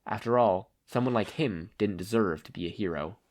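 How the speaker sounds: ordinary delivery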